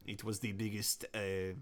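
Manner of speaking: put-on halting Japanese-accented studio announcement; mock Japanese accent.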